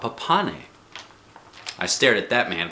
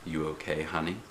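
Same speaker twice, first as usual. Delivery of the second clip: much softer, lower tender question; gentle concern; 6 semitones lower, 10 dB quieter than usual. A narrator's reading. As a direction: comfort a scared child. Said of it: "he said".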